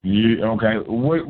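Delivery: usual speaking voice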